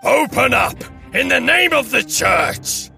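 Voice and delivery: high, strained demand through a door; distant, high-pitched order; commanding mob cry. A narrator's reading. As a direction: demand entry as a zealot. Red men pound at the door.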